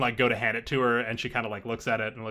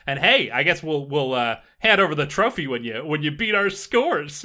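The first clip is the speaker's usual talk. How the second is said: higher, louder show-off band member; festival star; 7 semitones higher, 7 dB louder than usual. Acted. Festival star to newcomers about the trophy, higher-pitched send-off. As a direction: play a star sending off the newcomers.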